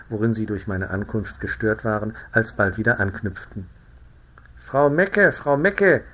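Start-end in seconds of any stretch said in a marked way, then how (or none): 4.6-6.0 higher, louder croaky frog gossip call. A narrator's reading. One frog calls to her neighbour across the pond. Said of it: "fing die eine Fröschin zur andern an".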